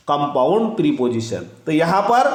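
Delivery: ordinary delivery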